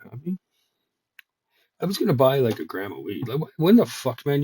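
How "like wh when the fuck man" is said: exasperated outburst. Real, not acted. Ranting about weed prices.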